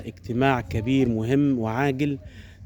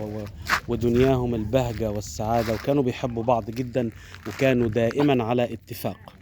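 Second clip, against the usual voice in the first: arabic grandma folk tale; 2 semitones lower, 3 dB quieter than usual. A narrator's reading. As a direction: tell it like a grandmother at bedtime. Folk tale opening narration.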